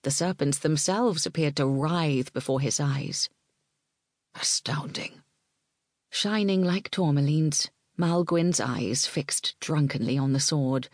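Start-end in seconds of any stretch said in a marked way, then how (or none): 4.3-5.2 whispered. A narrator's reading. Malgwyn's awed inner thought.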